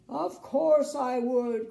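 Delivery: slow, loud cry; crying king. Acted. King cries out to Little Miss Sunshine, slow and loud. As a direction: cry it out slowly. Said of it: "cried the king".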